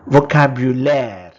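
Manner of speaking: first take, projected clearly for the class; loud model word. Shown as a demonstration. Teacher modelling a French word for learners, loud and clear. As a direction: call out the French word loudly. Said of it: "Vocabulary becomes".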